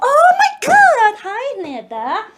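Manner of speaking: high, loud excited squeal; delighted pet greeting. Real, not acted. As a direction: squeal at a pet that just walked in. Greeting pets arriving in room.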